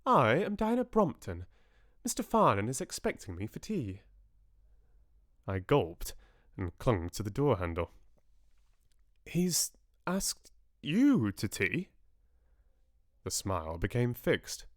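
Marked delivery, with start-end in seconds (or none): 0.0-4.0 high, refined lady's voice; cultivated voice, starting high; well-bred young woman. A narrator's reading. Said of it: "in a loud, well-bred voice". 9.2-11.9 slow, high, halting question; drawn-out astonished question; incredulous. A narrator's reading. Narrator gulps, incredulous at visitor.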